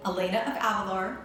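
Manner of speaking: ordinary delivery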